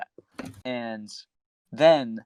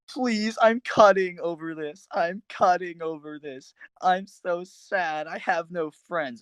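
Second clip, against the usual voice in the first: higher, drawn-out mock-sobbing whine; mock crying plea; 6 semitones higher, slower than usual. Friend imitating A K begging him in messages, mock crying. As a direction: plead pitifully, dragging out every word. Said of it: "crying in my messages".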